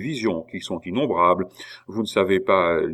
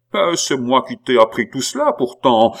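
louder, higher cry of pride; exclaiming; 4 semitones higher, 6 dB louder than usual. A narrator's reading. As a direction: exclaim it loudly, half consoled. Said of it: "s'écria l'abbé, presque consolé de la victoire". (exclamation sonore, presque consolée)

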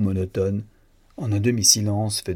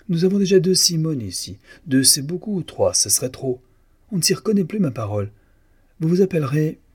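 high-pitched, emphatic objection from the boss; exclamation contrariée; 8 semitones higher, 3 dB louder than usual. A narrator's reading. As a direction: overrule the new girl in a high, exclaiming voice. Employer rejects a third Simone. (protestation haut perchée et péremptoire)

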